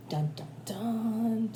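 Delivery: slow ominous dun dun dun; mock suspense music; suspense sting. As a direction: hum a dramatic sting, slowly. Reader adds suspense sting.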